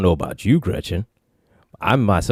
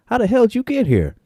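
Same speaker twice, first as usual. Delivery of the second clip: incredulous; 13 semitones higher than usual. Retold or imitated. Kirk interrogates stranger.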